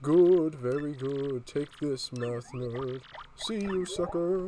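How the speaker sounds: taunting ghost, high at start and end; ghostly quizmaster handing out mocking praise. Boo rewards the right answer with a jeer, ghost voice. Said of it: "I'll give him a ghost voice".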